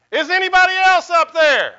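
high, loud hopeful shout upward; desperate yell. Acted. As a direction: holler it up, hoping someone else answers. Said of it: "finally he says".

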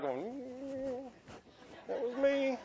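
quiet admission. Retold or imitated. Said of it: "you're like".

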